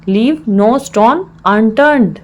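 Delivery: slow, word-by-word teaching delivery; slow repeat. Shown as a demonstration. Teacher repeats the English phrase for learners, slowly.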